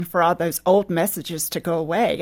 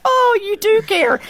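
high, loud, delighted gasp of relief; 13 semitones higher, 6 dB louder than usual. Retold or imitated. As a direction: squeal it, suddenly relieved he cares. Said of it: "And I was like".

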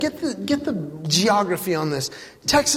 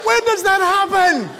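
incredulous; 10 semitones higher than usual. Acted. Comic disbelief at fashion.

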